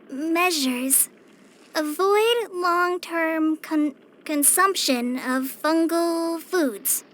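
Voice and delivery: slow, halting read-aloud; laboured reading. Acted. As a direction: sound out the long words haltingly. Struggles reading academic text aloud.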